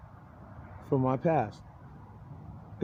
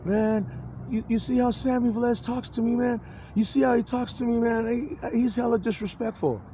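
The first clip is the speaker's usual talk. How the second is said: high, aggrieved complaining voice; whiny accuser; 7 semitones higher than usual. Retold or imitated. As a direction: tattle on him like a wounded victim. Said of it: "they have a log of".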